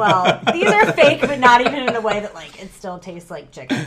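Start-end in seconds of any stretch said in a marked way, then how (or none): 0.0-2.2 loud, laughing explanation. Real, not acted. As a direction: explain while giggling.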